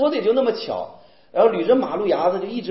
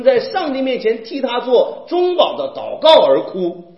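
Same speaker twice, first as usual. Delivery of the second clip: slow, raised, emphatic preacher delivery; forceful preaching; 5 semitones higher, 4 dB louder, slower than usual. Real, not acted. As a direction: press each phrase home to the congregation. (语气激昂地讲道)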